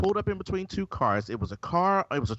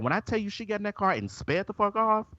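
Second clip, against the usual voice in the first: usual speaking voice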